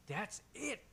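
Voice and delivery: fed up, loud on damn it; exasperated. Acted. NPC cursing at a stubborn kid, exasperated. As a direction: snap in frustration. NPC loses patience, swears.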